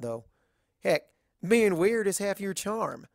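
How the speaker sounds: lightly voiced Southern farm mare, frank and friendly; level with her like a blunt country friend; a hint of a drawling Southern mare, teasing; Applejack voice.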